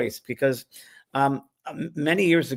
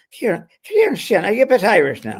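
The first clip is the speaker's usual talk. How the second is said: mock Irish lilt, high and loud; a playful stab at an Irish accent; 9 semitones higher, 7 dB louder than usual. Host greeting the Irish singer in a mock Irish accent. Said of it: "it's an Irish name".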